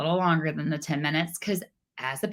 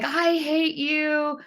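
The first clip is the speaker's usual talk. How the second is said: stretched-out, much higher mock "I hate you" of a furious son; imitating angry teen; 9 semitones higher, much slower than usual. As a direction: play the sulky teenager yelling at mom, drawn out. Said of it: "He's like".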